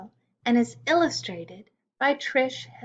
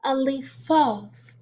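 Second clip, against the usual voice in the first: put-on reading a poem to children. A narrator's reading.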